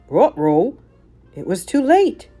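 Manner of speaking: ordinary delivery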